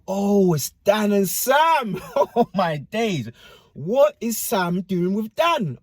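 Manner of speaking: high, slow, stunned exclamation; shocked disbelief. Retold or imitated. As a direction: drag it out like you can't believe your eyes. Seeing ex with friend.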